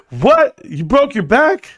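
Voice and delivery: high-pitched shocked questioning; startled, incredulous questions. Real, not acted. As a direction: jump up high in disbelief. Reacts to chat's broken back claim.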